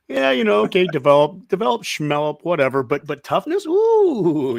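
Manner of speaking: high, revved-up fan building to a whoop; playfully excited. Pretend. Fan reacting to a clip on toughness, playfully excited. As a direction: play up the thrill, swooping high on the ooh. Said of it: "I'm getting excited".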